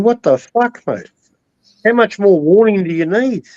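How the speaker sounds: ordinary talk, nothing marked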